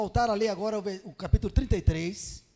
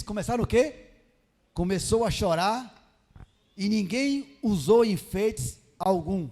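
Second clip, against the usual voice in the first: loud, slow, emphatic Bible reading; scripture reading; 5 dB louder, slower than usual. Acted. Preacher reading God's words to the congregation, emphatic scripture reading. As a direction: read it out firmly, phrase by phrase.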